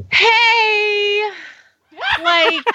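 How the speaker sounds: high hammed-up teen girl voice; bubbly valley girl teen; teenager voice. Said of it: "as the best teenager voice that I have".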